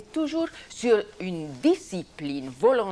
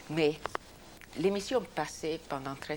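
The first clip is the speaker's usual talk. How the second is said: ordinary talk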